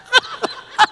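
short high cackle of joy; laughing. Real, not acted. Laughs as act of faith.

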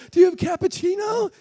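high, loud, eager blurt; bursting with childish eagerness; excited shout. Retold or imitated. Excitedly asks the flight attendant.

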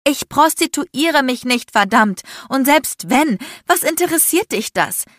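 high, loud, exasperated outburst; furious denial. A narrator's reading. Accused woman tells the man off, furious denial. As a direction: protest at full heat, fed up.

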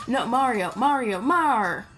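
calling after Mario, stretching the last cry; building wail of mock dismay; mock distressed calling. Player pleading with Mario as he falls, mock distressed calling.

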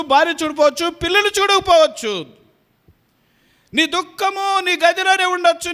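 high, building plea to the flock; fervent preaching. Acted. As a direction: lift the voice, preach with full heart. Impassioned preaching climax.

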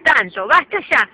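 loud firm telling-off; stern scolding. Real, not acted. Owner loudly scolding her screaming parrot. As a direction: clamp down on a screeching bird.

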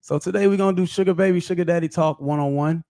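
ordinary talk, nothing marked

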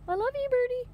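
high cooing pet voice. Real, not acted. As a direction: coo it sweetly to the bird. Cooing to her pet parrot.

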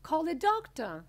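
high, loud urgent call; shout. A narrator's reading. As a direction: shout for help as the father. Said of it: "shouted Mister Beauregard".

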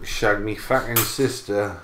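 low, soft mock Australian with a Cockney slip; badly faked Australian accent; attempted Australian accent. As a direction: try Australian and land near Cockney. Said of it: "more Cockney than my Australian attempt".

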